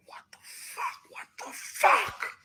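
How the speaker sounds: drawn-out whisper building to a forceful rasp; whispered outburst, retold; stunned whisper. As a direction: whisper it slowly in disbelief, then push the last one. Retold stunned reaction in the moment.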